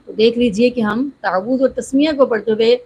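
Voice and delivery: ordinary talk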